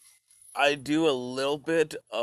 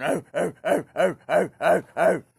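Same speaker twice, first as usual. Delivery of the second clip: rising, louder primal grunts, building as they go; caveman grunts; 4 semitones higher, 5 dB louder than usual. Retold or imitated. Cavemen facing each other over raw mammoth meat, building grunts. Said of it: "looking at each other and going".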